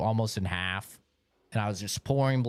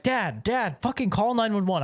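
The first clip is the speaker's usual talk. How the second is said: panicked plea; 8 semitones higher than usual. Retold or imitated. Crying, begging dad to call.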